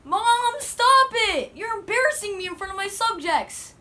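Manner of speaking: put-on embarrassed kid shouting; whiny yell. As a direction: yell at mom, mortified. Embarrassed kid yelling at mom.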